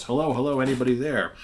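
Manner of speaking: shipwrecked captain calling at the lighthouse door. Acted. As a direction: call out to find anyone inside.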